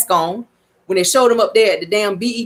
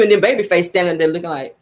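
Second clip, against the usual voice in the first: ordinary delivery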